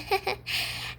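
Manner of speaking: quick unvoiced giggle; breathy giggle. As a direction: giggle slipping out mid-sentence. Giggle mid-sentence while confessing.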